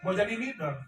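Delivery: loud, high-pitched insistence; seruan tegas. Real, not acted. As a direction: shout it as a challenge to the audience. Menegaskan lagi kepada audiens. (seruan lantang menantang)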